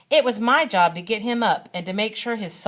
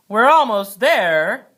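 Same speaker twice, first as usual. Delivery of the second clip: slow, louder spooky call across the dark; kind of scary voice; 4 semitones higher, 9 dB louder, slower than usual. A narrator's reading. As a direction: drag it out like a ghost story dad. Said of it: "in a kind of scary voice".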